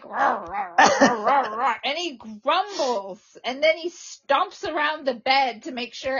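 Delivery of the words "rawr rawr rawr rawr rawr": playful mock growls, the middle ones louder; grumpy dog growl. Scene: owner mimicking her dog when it gets touched, mock growls. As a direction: grumble like a dog that's been touched. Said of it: "He's like".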